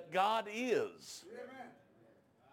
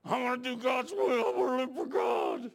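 loud, raised plea of a weeping man; crying plea; 5 semitones higher, 4 dB louder than usual. Retold or imitated. Big weeping convert at the altar, loud plea. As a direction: cry out that you want to live for God. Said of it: "and he goes".

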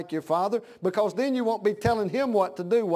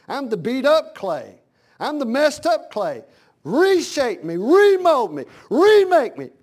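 building from raised voice to high shout; escalating preacher's cry to God; fervent plea; 6 semitones higher, 8 dB louder, slower than usual. Acted. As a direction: pray it harder with each phrase. Acted prayer, building in fervor.

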